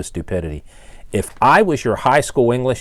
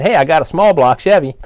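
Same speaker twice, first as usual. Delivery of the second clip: mock caller; 6 semitones higher, 5 dB louder than usual. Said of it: "And if you said".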